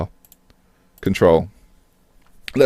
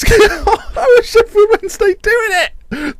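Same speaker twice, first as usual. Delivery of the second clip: high, slow laughing question; helpless laugh at the scoreline; laughing disbelief; slower than usual. Real, not acted. Stunned laugh at rival result.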